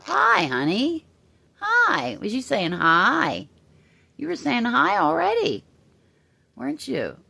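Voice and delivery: high cooing voice for the bird. Real, not acted. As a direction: coo up high to your pet. Baby talk to pet bird.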